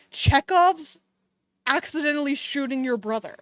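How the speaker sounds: usual speaking voice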